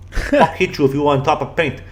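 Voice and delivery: a hint of a Russian accent, broken English; mock Russian coach. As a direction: imitate the pushy Russian coach in a light Russian accent. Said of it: "this crazy Russian bear".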